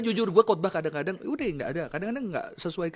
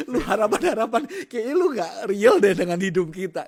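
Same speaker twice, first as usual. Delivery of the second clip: mock complaint, loud and high with laughter; loud, raised-pitch retort, half laughing; young person protesting; 8 semitones higher, 7 dB louder than usual. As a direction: imitate an exasperated kid talking back. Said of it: "Dia bilang".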